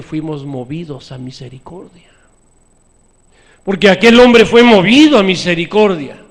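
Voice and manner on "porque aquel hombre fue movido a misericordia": loud, higher rhetorical question; preaching at full force. Acted. Preacher challenging the congregation, loud preaching.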